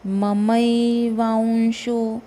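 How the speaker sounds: very slow ममैवांशो, each syllable drawn out; careful pronunciation. Shown as a demonstration. Teacher showing how to say ममैवांशो, very slowly. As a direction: stretch ममैवांशो slowly and clearly. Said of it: "वांशो नहीं बोलना है".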